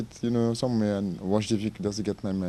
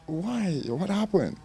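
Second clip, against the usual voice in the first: retold shout; 9 semitones higher, much slower than usual. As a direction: re-enact a shocked shout at ordinary volume. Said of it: "So I just shout".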